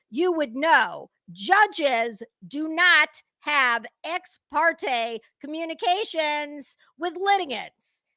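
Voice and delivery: put-on very slow, each word laid out as if to a child; slow condescending lecture. Explaining law to Betty, very slow and condescending. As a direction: spell it out for the idiot.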